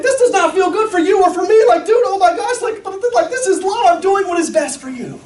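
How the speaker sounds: high, loud desperate pleading; acted anguish. Father pinning down crying son, high hammed-up desperate plea.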